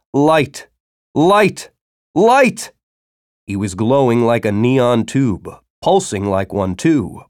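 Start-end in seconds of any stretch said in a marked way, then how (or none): 0.1-2.7 each cry higher than the one before; rising cries. Acted. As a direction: build to a frantic cry. Delirious man cries out.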